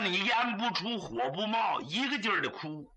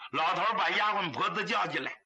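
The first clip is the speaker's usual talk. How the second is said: ordinary delivery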